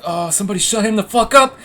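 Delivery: high, loud retold yell; exasperated outburst. Storyteller re-enacting his plea to friends, exasperated yell. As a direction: build to a fed-up shout. Retells own freak-out at friend.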